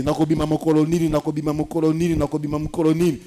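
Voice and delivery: anxious, rapid-fire repetition; racing worried question, over and over; worried Noah. Retold or imitated. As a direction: repeat it fast, fretting like Noah. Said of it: "de s'inquiéter".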